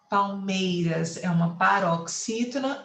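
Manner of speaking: usual speaking voice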